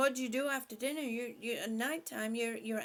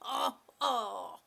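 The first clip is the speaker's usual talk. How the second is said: laughing, louder teasing; laughing "you dog"; laughing tease; 4 dB louder, slower than usual. Real, not acted. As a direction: scold him playfully through laughter. Teasing viewer after joke.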